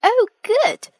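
high, loud burst of joy; delighted cry. A narrator's reading. Peppa thrilled at the rain news, crying out high and loud. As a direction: squeal it out happily. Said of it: "cries Peppa".

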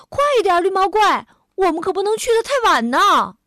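high, loud, hurrying call; urging. A narrator's reading. Louise hurries the monster to the amusement park, urging. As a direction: call out impatiently to a dawdler. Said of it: "路易斯催促着".

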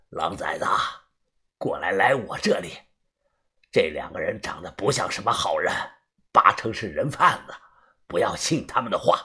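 low, hushed rasping warning; a wary old drunk, half whispered; hoarse drunk man. A narrator's reading. Hoarse drunk man warns kids.

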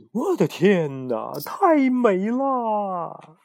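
slow, drawn-out, high amazed exclaim; marvel, drawing each word out; slow, high entranced wonder; awestruck. Acted. Dazzled by scarab's glow.

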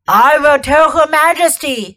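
menacing wolf; a hint of a wolf. A narrator's reading.

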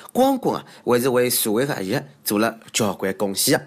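usual speaking voice

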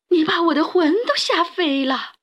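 high-pitched frightened complaint; old woman crying out. A narrator's reading. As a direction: exclaim as if scared witless. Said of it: "瘦小的老妇人喊起来".

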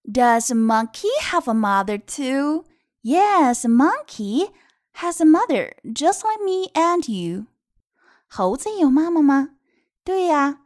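put-on lilting question and answer for children; picture-book reading. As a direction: read it out expressively to little ones. (有起伏地给孩子读绘本)

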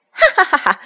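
high, loud mocking cackle; cackling laugh. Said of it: "青蛙们放肆的笑声".

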